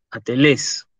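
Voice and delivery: atelés with -LÉS stressed; final stress. Shown as a demonstration. Teacher repeats the word, stress on eta. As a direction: repeat atelés carefully, weight on the last e. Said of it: "El acento sobre la eta".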